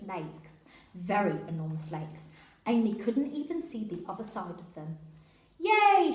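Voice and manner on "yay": high, loud cheer; a joyful shout of victory. Acted. Girl cheering the escape, shouting loudly. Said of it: "shouted Janet".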